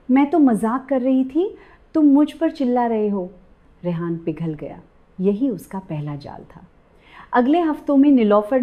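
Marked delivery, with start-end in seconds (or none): 0.1-3.3 a touch of put-on crying, pitched higher; fake tearful. A narrator's reading. Bride fakes tears to her husband, mild tearful whine. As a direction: whine as if about to cry, lightly acted. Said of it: "Nilofar तुरंत रो पड़ी".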